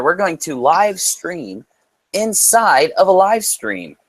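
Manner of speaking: slow, lifted build-up; slow emphatic announcement. Shown as a demonstration. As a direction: spell it out slowly like a big reveal. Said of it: "slow emphatic announcement".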